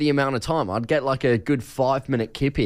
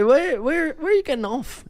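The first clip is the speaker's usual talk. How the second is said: much higher, lightly voiced elderly asker; elderly couple; 11 semitones higher than usual. Retelling the elderly couple waking him, lightly higher voice. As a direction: pitch it way up like a fussy old stranger. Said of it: "They say".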